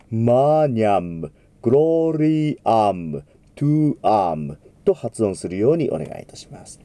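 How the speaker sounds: マーニャム, close the lips on the final ム; lips closed on M. Shown as a demonstration. Teacher modelling the closed M for the choir. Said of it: "必ず唇を閉じて".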